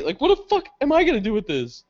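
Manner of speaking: high-pitched retold exasperation; mad, pissed. Stuck holding a stranger's ID, mock exasperation. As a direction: complain up high, acting annoyed. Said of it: "I'm mad at this point".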